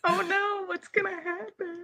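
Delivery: high, laughing fake alarm; hammed-up worry, fading soft; mock panic. Mock panic quoting game moment.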